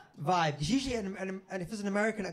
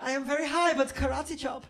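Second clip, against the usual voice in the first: lightly voiced German guy, pitched higher; a comic high-pitched threat; mock German tough guy; 8 semitones higher than usual. Big German guy threatening the comedian, mock menacing.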